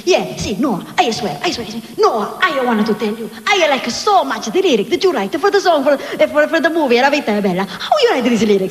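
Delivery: put-on effusive Italian accent, building from earnest to gushing; Roberto Benigni. Italian director praising the singer's lyrics, effusive impression. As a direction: pour out praise like an excitable Italian. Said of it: "That's the way he talks".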